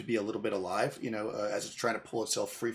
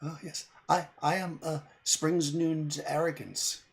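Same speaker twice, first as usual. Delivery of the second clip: put-on fey girl voice; 5 semitones higher, slower than usual. Fey girl gives her name.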